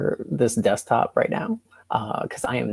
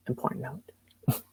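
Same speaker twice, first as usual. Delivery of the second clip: whispering; 3 semitones higher, 12 dB quieter than usual. Real, not acted. Aside before poem title.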